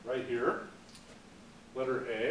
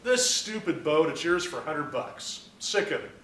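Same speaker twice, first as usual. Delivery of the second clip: normal talking voice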